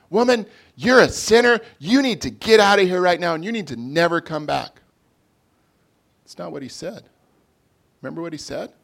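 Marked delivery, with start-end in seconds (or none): 0.1-4.8 much higher, louder pretend rebuke; hypothetical furious scolding; harsh condemnation. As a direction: bark it out like a condemning judge. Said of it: "Jesus didn't stand up and say".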